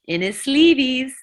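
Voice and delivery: punchline voice. Retold or imitated. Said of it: "And he goes".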